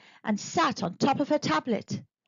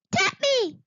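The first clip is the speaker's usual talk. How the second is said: high squeaky little creature voice. Acted. Pink thing begging Eddie, high squeaky creature voice. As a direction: chirp it like a tiny gremlin. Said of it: "the pink thing said".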